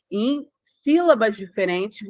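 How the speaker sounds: ordinary delivery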